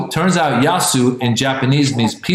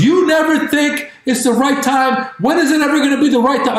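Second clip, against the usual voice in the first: high, heated wifely complaint; exasperated wife; 12 semitones higher than usual. Acted. Wife arguing with husband about a dog, high exasperated outburst. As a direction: fire back in a much higher voice. Acted quarrel, wife objects.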